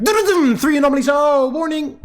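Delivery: high, loud, fast parody of the game's alarm; rapid-fire game mockery. Gamer mocking the game's rapid-fire warnings, high and loud. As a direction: rattle it off like a taunting alarm. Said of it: "The game just goes rapid fire, like".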